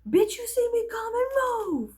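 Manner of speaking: hammed-up brash challenge; mock confrontational yell. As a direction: imitate a tough friend clearing the way. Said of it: "she'd be like".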